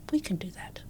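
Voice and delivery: soft whispered promise; quoted whisper. Retold or imitated. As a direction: whisper it like a secret deal. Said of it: "And he went".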